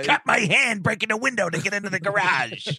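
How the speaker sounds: high-pitched put-on complaint; annoyed TV character impression. Podcaster quotes Klompus about his cut hand, high mock complaint.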